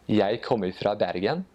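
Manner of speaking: Eastern Norwegian dialect, rising at the end. Shown as a demonstration. Guest showing the Eastern Norwegian way to say it. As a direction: say it the Oslo way, as on TV. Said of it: "in the Eastern dialect".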